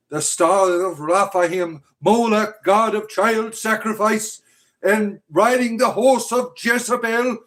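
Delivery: high, slow, hammed-up villain proclamation; mock Antichrist, gloating. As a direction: confess grandly as a theatrical arch-villain.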